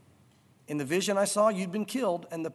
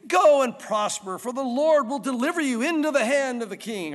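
obvious sarcasm; 4 semitones higher, 6 dB louder than usual. Acted. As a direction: proclaim it grandly with obvious insincerity. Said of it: "probably very obviously sarcastic".

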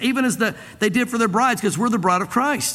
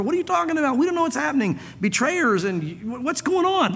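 higher, bewildered, voice of the confused disciples; 7 semitones higher than usual. Retold or imitated. Disciples puzzle over Jesus' words, high and bewildered. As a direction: squeak it out like frightened, lost followers.

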